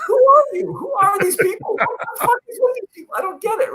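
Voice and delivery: high, fast, laughing squeal of confusion; laughing disbelief. Retold or imitated. Player retells being told I hate you, laughing high. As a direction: squeak it out mid-laugh, baffled.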